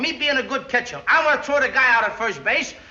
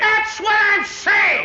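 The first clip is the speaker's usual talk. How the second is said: excited insistence; 6 semitones higher, much slower than usual. Acted. Ballplayer insisting to the manager, slow and exasperated. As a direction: hammer it home, fed up with the runaround. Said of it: "Don't get excited".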